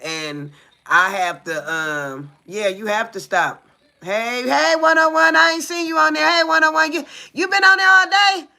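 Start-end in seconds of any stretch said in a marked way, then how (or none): 4.0-8.5 loud, high greeting to someone in the chat; raised, excited shout-out to a live viewer; calling out. Real, not acted. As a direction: hail a viewer by their number.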